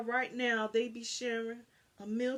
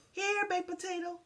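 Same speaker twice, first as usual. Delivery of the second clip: calling out; 10 semitones higher than usual. A narrator's reading. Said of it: "she called for him".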